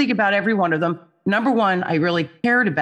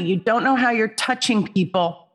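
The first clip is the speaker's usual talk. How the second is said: normal talking voice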